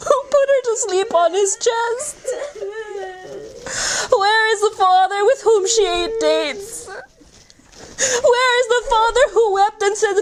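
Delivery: high, sobbing cries of where; wailing lament. Real, not acted. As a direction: wail each question through tears.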